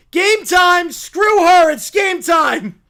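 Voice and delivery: loud, high, hammed-up yell; shouted rallying cry. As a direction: holler it like a game announcement. Acted character calls game time.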